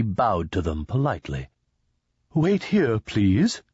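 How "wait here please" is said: high, chirpy little voice; chirping alien guide. A narrator's reading. Indui guide asks guests to wait, high chirp. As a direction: chirp it politely, pitched up. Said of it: "he chirped".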